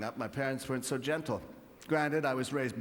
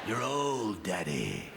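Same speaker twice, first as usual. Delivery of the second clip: put-on weird kid voice; 8 semitones lower, slower than usual. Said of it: "It's got a weird voice".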